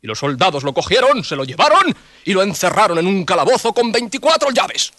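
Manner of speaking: ordinary delivery, nothing marked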